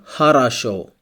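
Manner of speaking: "хорошо" said ha-ra-SHO; unstressed O as A. Shown as a demonstration. Teacher models an example word for learners, "хорошо" as ha-ra-SHO. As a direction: say "хорошо" with both unstressed o's as a. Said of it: "it's pronounced like a".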